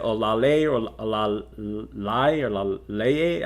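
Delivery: Olale, Olalai, Laleye sounded out slowly, each a guess; trying out a name. Shown as a demonstration. Host unsure how to say a viewer's name, slowly sounding out Olale, Olalai, Laleye. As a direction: slowly test three ways of saying Olale. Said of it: "I'm not sure if that's how you pronounce it".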